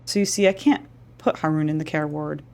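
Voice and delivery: normal talking voice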